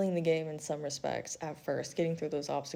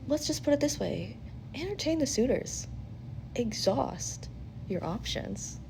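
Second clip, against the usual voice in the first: sly, higher knowing advice; 6 semitones higher than usual. Acted.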